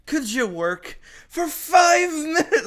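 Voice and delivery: high, slow, laughing whine; laughing mock plea. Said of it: "It's like".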